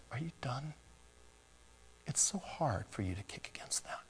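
tender whispered voice of Christ; whispered Jesus. Acted. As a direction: whisper it as a loving question. Voicing Jesus gently to sinner.

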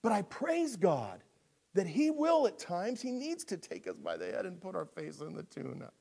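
normal talking voice

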